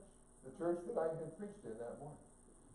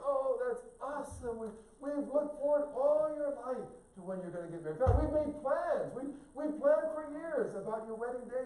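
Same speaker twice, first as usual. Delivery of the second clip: excited parents; 10 semitones higher, 6 dB louder than usual. Retold or imitated. Parents thrilled at engagement.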